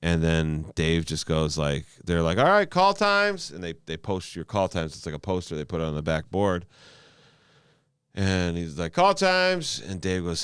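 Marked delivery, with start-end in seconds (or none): 2.4-3.5 high, drawn-out backstage announcement; imitated sing-out to the whole room; calling out. Imitates backstage call to the comics. 8.9-9.8 high, loud backstage call; imitated announcement, pitched way up.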